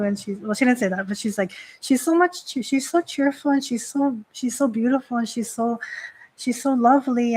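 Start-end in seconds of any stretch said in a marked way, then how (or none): 1.8-7.3 higher-pitched retold gushing, easing down; mock gushing praise. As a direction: lightly act out admiring a new goddess. Voicing gushing praise of rival goddess.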